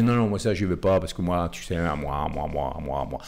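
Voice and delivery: low, sulky me-me-me voice; self-absorbed mock voice. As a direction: mumble it like a self-centered grump. Mimics self-centered closed people. (voix nombriliste moqueuse)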